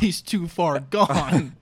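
slow, drawn-out mock gravity; mock dramatic. Joking about the escaped shooter, mock dramatic. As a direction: say it like a grim movie line.

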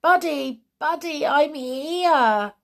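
high, loud joyful call; calling joyfully. Acted. Boy Robin calling to his dog at the kennel, joyful call. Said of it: "calling joyfully".

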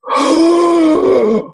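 a sharp, high intake of breath in recognition; starstruck gasp. Retold or imitated. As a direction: gasp as if seeing a star. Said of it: "He looks at me and he goes".

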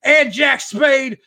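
mock challenge shout; hammed-up wrestling promo. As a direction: call out the champs like an old heel manager. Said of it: "And he's like".

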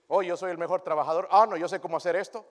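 loud, high bragging voice; boastful mock. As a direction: brag like a know-it-all. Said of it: "Nos gloriamos todo el tiempo". (voz presumida y fanfarrona)

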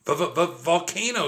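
slow, frightened stutter, pitched high; scared stammer about the volcano. A narrator's reading. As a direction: trip over the v in fear. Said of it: "stuttered Jack".

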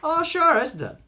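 high, hearty mock-Irish agreement; Irish lilt, loud then easing off; agreeable Irish accent. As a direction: agree like a cheery Irish local. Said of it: "It's the dead opposite in Ireland".

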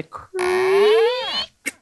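high, long-held creaking word; imitated door creak. A narrator's reading. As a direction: stretch "creak" like an old hinge.

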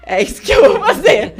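loud, high eager comeback breaking into laughter; fired-up retold reply. Fighter answering her coaches' challenge, loud fired-up reply. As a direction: re-enact an eager, shouted yes.

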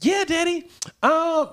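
high-pitched daughter voice; high little-girl voice. Retold or imitated. Father imitating his daughter, high childlike voice. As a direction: sound like a sweet twelve-year-old. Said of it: "She said".

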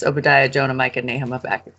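usual speaking voice

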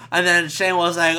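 ordinary talk

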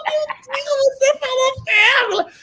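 high, laughing squeal; giggling disbelief. Retold or imitated. (esposa rindo alto, voz bem aguda)

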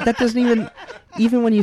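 high, loud, laughing protest; laughing while talking. Real, not acted. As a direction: crack up at your own silly attempt. Cracking up after own accent attempt.

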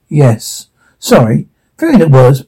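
ordinary delivery

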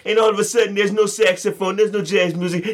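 high put-on French accent, hammed-up; high exaggerated fake French voice; mock French accent. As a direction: play a Frenchman griping about missing jazz. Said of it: "I thought that was French the whole time".

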